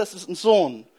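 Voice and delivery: ordinary delivery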